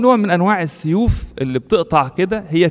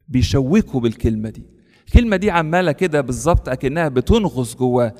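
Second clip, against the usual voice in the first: normal talking voice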